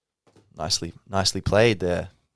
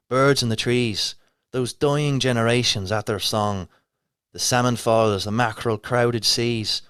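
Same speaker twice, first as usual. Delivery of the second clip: put-on Irish accent; 3 semitones higher, 4 dB louder than usual. Reciting Yeats for viewers in a put-on Dublin Irish accent. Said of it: "Shall I do it in an Irish accent?".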